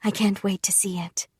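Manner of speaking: whispered, eager mother. Acted. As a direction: confide her excitement in a hush. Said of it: "said Mother".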